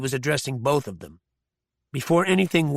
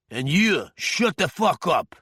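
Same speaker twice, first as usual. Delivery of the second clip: higher, slow, biting menace; slow angry threat; 4 semitones higher than usual. A narrator's reading. Osirov telling Arkadin to shut up, slow anger. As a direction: spit it out slowly at him.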